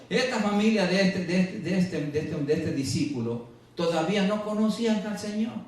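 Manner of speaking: ordinary talk, nothing marked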